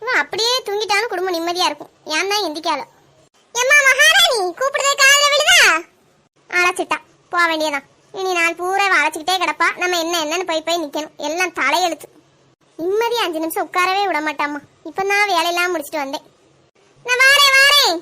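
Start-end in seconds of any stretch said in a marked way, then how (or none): 3.5-5.6 mother-in-law hollering, higher and mocking; calling out sarcastically to the daughter-in-law; sarcastic call. 17.1-18.0 higher, louder, grudging reply; answering the mother-in-law's call, annoyed; calling back. Acted. Daughter-in-law answers the call.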